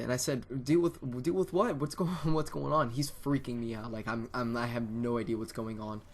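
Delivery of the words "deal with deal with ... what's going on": high, raised-voice bewildered questions; retold panic. Kid asking dad what he means, acted-out alarm. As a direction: act out stammered, alarmed questions. Retelling confused reply to dad's threat.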